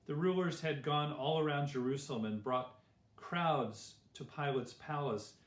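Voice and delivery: ordinary delivery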